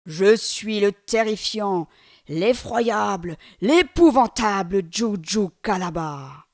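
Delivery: huge, slow, loud demon roar; terrifying demon's slow thundering boast; booming monster voice. Acted. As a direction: stretch each word out like a giant announcing himself. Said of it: "crie d'une énorme voix".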